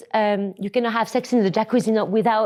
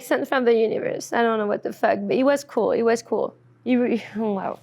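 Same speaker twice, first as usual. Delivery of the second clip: usual speaking voice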